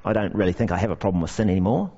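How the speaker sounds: a mildly mocking complacent churchgoer; smug dismissal. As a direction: toss it off glibly. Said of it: "more worrying responses like".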